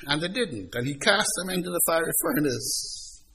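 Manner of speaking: normal talking voice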